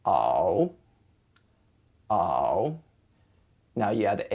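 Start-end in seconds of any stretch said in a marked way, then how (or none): 0.1-2.8 "ow" dipping low, then rising; slow low dip and rise on "ow". Shown as a demonstration. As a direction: drop "ow" down and swing it back up. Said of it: "has a dipping tone".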